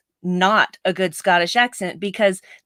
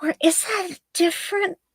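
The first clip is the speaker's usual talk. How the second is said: mock bewilderment, pitched way up; puzzled; 8 semitones higher, faster than usual. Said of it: "I'm like".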